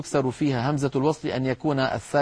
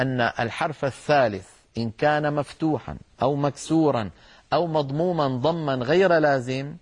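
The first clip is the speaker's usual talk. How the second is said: ordinary talk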